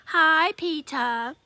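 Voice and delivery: drawn-out sly hi; little brother teasing after dropping ice down a back; slow cheeky greeting. Acted. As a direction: stretch it out, all innocent mischief. Fudge teasing after ice prank.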